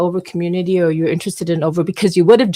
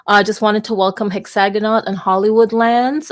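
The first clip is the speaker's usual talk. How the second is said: ordinary delivery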